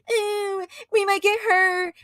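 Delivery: a hint of whiny mockery; mocking whine. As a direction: sneer at the softies. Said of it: "mocking NFL players".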